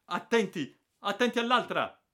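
very high, loud cry; desperate yell of alarm; urgent warning. Acted. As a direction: shriek at them to watch out. Shouted warning during attack.

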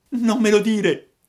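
very high, loud puppet cry; frightened yell. A narrator's reading. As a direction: cry out in dread. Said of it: "urlò Pinocchio, che tremava ancora dalla paura". (urlo acuto di paura)

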